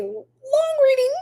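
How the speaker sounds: high laughing remark, slowed down. Real, not acted. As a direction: laugh through the words. Laughing at own long reading.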